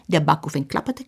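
ordinary delivery

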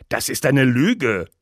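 loud, slow, furious accusation; thundering. A narrator's reading. Condemned poet accusing the judge of lying, thundering. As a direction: thunder it out, drawing out the words. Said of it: "donnerte Ofterding".

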